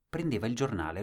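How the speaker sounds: ordinary delivery, nothing marked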